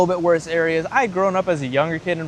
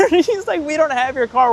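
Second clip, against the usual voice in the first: much higher, laughing through the words; real laughing; 10 semitones higher than usual. Real, not acted. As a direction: crack up while repeating the confession. Cracks up repeating the friend's confession.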